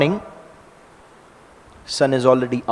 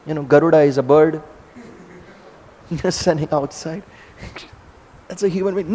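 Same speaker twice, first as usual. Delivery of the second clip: normal talking voice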